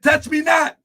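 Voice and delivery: loud, high warning: "Touch me not"; "Touch me not" thrown out sharply to stop her; loud command. Acted. Jesus warning off, preached loudly.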